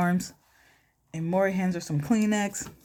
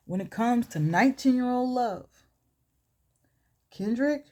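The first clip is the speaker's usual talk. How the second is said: lightly voiced talk-show host reveal; mock show announcement; 5 semitones higher than usual. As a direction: read out the verdict with suspense, slightly raised. Acting out Maury's dramatic result reading.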